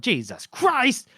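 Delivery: high, drawn-out shocked cry of Jesus Christ; shocked exclamation. Retold or imitated. Companions aghast as Dao charges the walls, a slow high shocked cry. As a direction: gasp it out as your friend runs off.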